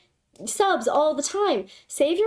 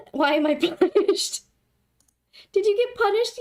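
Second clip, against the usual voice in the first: normal talking voice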